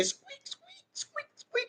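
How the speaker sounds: very high, tiny squeaking rat; soft, very high rodent squeaks. Pretend. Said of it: "How they say".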